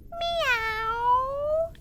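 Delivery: slow, high drawn-out meow; cat meow. Shown as a demonstration. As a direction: stretch the meow like a lazy cat. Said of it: "let's try meowing like a cat".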